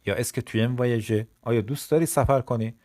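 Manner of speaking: ordinary talk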